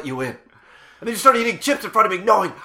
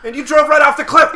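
raised, loud, indignant accusation; angry accusation; 8 semitones higher, 11 dB louder than usual. Acted. Reminding friend of the birthday disaster, angry accusation. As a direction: throw the blame at him loudly.

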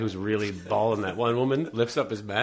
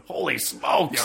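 Stan Lee bit; 5 semitones higher, slower than usual. Retold or imitated. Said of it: "Stan Lee going".

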